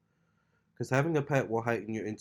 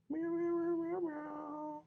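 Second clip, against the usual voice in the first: high, squeaky kitten meows; cat meows; 6 dB quieter, slower than usual. Pretend. Owner meowing at her kitten to say hi, high and squeaky. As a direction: mew like a tiny kitten.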